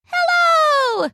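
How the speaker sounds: very high, bright, tiny fairy greeting; a sparkly little fairy saying hello; pleasant little fairy voice. Acted. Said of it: "said the fairy in a pleasant little voice".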